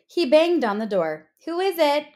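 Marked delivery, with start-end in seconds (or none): 1.4-2.1 calling out. Acted. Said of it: "called the first pig".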